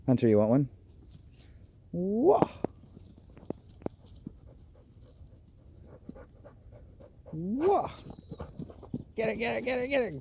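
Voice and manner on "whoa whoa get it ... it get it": very high, playful egging on; excited dog talk. Real, not acted. Owner hypes her dog to chase the ball, very high.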